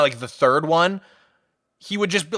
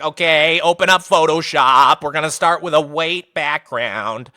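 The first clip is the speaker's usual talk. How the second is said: slow, bleating goat-like impression; billy goat teacher. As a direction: drag the words out like a goat. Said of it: "this guy sounded like a billy goat".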